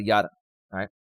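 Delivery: ordinary delivery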